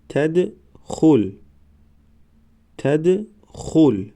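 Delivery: slow, syllables held and separated; slow syllable reading. Shown as a demonstration. Teacher models first readings for learners, slow syllable by syllable. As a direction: sound it out for learners, pausing between syllables. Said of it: "بار یکم و دویم آهسته".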